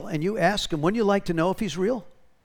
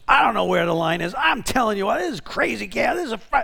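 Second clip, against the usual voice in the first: higher-pitched, harried cashier overwhelmed by the crowd; frazzled cashier; 5 semitones higher than usual. Retold or imitated. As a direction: play the swamped cashier throwing up her hands. Frustrated cashier Gloria quoted.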